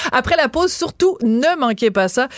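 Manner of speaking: usual speaking voice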